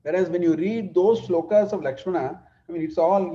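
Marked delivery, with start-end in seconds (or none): none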